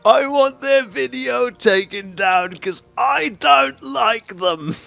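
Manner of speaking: high, slow mocking whine; hammed-up high voice mocking the guy; mock whiny complainer. Podcast host mocking a creator's takedown demand, high whiny voice.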